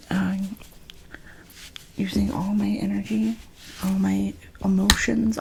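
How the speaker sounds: normal talking voice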